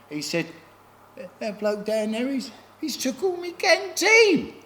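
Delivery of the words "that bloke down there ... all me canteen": imitating crying friend. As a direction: whine it like a wimpy little friend. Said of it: "He went crying".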